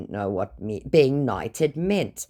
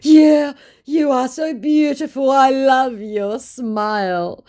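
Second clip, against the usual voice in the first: much higher, slow and louder gush; flattering scammer; 12 semitones higher, 7 dB louder, slower than usual. Retold or imitated. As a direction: lay on the flattery thickly. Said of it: "my scammer said".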